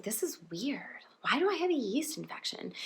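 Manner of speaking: hushed, whispered aside to yourself; puzzled whisper, very soft; whispered puzzlement. Retold or imitated. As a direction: whisper it, baffled, as a private thought. Mock-puzzled thought to herself.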